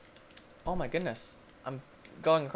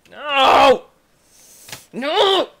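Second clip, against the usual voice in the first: loud, high cry of protest; dismayed shout; 9 semitones higher, 11 dB louder than usual. Real, not acted. Player stuck at the bottom of the level, dismayed shout. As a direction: yell it in exasperation. Lost his arms in the game.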